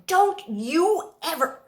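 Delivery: a mother's reprimand cut short; starting to yell. Acted. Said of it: "I'm about to yell at him".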